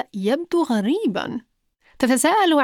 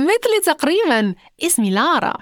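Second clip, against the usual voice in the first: unable to hold back laughter; 6 semitones higher than usual. A narrator's reading. Lara hearing Magha's similar name, amused and giggling. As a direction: giggle first, then high-pitched amusement. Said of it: "لم تستطع لارا أن تكتم ضحكتها".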